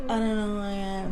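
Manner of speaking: lightly mimicking a monotone girl who lost herself; mock monotone. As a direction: drain the feeling out, deadpan. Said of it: "Like that".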